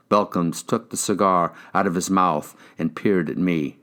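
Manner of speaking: usual speaking voice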